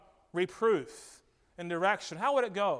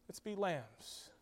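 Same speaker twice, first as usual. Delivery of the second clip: much softer, fading echo; softer repeat; 11 dB quieter than usual. Shown as a demonstration. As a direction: let the words sink in quietly. Preacher repeats exhortation softer.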